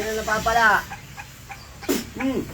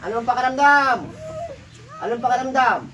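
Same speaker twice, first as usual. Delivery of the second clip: loud, raised demand for an answer; forceful questioning; 5 semitones higher, 4 dB louder than usual. Real, not acted. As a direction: press the question hard, higher and louder. Healer presses patient for an answer.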